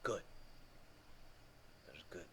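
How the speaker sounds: low whispered rumble; deep rumbling voice. Acted. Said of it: "He's like".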